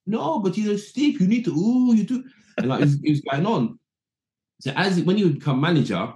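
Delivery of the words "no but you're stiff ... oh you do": hammed-up Ruud insisting; imitating Ruud Gullit. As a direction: manager insisting the young player is stiff. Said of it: "Ruud's going".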